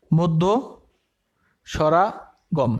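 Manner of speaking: the term drawn out very slowly; slow dictation. Shown as a demonstration. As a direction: stretch each syllable for the notebook. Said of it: "এখানে লিখি মধ্য স্বরাগম".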